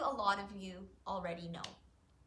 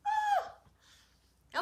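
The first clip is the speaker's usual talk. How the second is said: high mock scream for the kids; playful scream; 5 dB louder than usual. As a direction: shriek like a crocodile just appeared. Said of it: "don't forget to scream".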